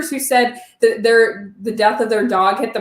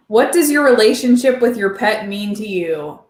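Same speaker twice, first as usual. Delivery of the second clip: ordinary talk, nothing marked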